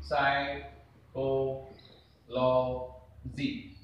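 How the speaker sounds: "साइकोलॉजी" drawn out very slowly, each syllable held apart. Shown as a demonstration. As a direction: stretch "साइკोलॉजी" into its separate syllables for the class. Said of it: "हमेशा आप हिंदी का सहारा ले सकते हैं".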